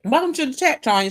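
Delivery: chewing gum while talking; mouth full. Real, not acted. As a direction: talk around a wad of gum. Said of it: "I had some gum in my mouth".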